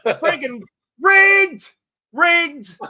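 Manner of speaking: high, loud, drawn-out mock protest; hammed-up cry of foul; mock outraged cry. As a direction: holler it slowly like a cheated punter, in jest. Joking protest at botched giveaway draw.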